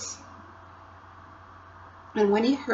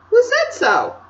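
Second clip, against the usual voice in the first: put-on much higher, louder girl challenging; Lucy's voice; 8 dB louder than usual. Lucy questioning Edmund, high and indignant. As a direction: demand it indignantly.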